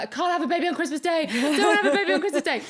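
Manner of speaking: high, loud, fast laughing protest; half-laughing Christmas panic; panicked protest. Retold or imitated. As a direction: wail it in mock panic. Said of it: "I was like".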